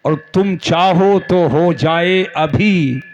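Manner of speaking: slow recital, building to the close; verse recitation. Acted. Preacher quotes Ala Hazrat's couplet, slow measured recitation. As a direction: stretch each phrase, lift the last one.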